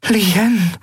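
very high, shocked cry of a name; horrified. A narrator's reading. Mother hearing of her daughter's loss, horrified. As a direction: exclaim the name, aghast. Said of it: "Ihre Mutter hielt entsetzt".

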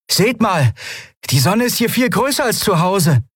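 very high, eager voice; excited marvelling. Acted. Miko at the pane shows crew the sun, excited. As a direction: call them over to look.

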